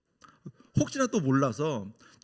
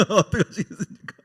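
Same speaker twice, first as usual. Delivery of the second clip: laughing question; 4 semitones higher, 3 dB louder than usual. Real, not acted. Laughing while asking congregation.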